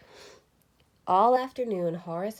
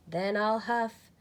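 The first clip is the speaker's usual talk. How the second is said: wolf threatens the straw house, building to a cry; 5 semitones higher, 6 dB louder than usual. A narrator's reading. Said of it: "cried the wolf".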